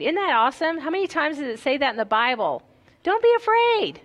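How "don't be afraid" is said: emphatic exclamation. Real, not acted. Preacher exclaims scripture to congregation.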